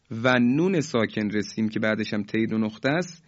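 ordinary talk, nothing marked

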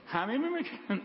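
ordinary talk

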